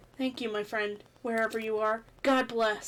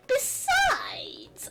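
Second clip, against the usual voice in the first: high. Acted. Character voice squeak.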